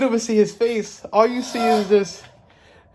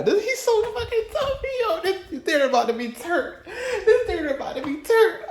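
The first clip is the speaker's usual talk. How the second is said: much higher, crying-laughing squeal of awe; fangirl squeal; 13 semitones higher than usual. Real, not acted. Fan squealing at the trailer villain, tearful excited squeal. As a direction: squeal with delight, near tears.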